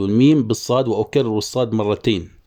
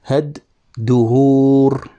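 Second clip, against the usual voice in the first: very slow, stretched syllables; syllable scanning; 4 dB louder, much slower than usual. Shown as a demonstration. Teacher scanning verse for the class, slow and stretched. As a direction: draw out each beat of the foot. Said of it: "هنا فاعلان".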